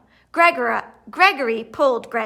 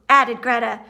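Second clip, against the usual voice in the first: ordinary delivery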